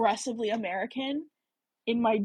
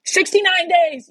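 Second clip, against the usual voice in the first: loud, high, drawn-out cheer; excited announcement; 9 semitones higher, 10 dB louder, slower than usual. Real, not acted. Triumphant announcement of googled count.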